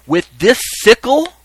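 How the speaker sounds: high, loud, slow question; crying out. Acted. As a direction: cry it out like a ceremonial reaper. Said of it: "then the reaper would cry".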